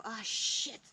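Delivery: ordinary talk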